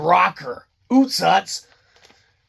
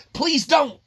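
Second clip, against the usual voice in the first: higher, mocking the viewer's plea; 8 semitones higher than usual. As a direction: whine it like a fed-up viewer. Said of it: "you might be saying to yourself".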